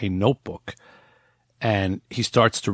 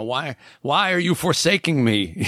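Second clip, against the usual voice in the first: higher, mock antsy complaint; mock impatient whine; 8 semitones higher than usual. Impatient believer complaining to God about the late sandwich, mock whine. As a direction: whine like a customer kept waiting, with a laugh. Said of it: "we start to get antsy".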